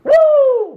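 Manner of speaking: high, loud "woo" to release emotion; emotional whoop. Real, not acted. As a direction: whoop high through the tears. Emotional release while crying.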